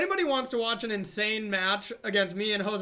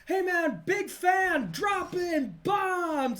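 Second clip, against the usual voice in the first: high excited shout across the airport; fan shouting; 8 semitones higher, much slower than usual. Retold or imitated. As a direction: yell it like an overexcited fan. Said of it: "screamed out when I was in".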